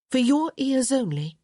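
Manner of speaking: high, urgent warning. Acted. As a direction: warn him to keep it secret. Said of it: "Hyacinth warned".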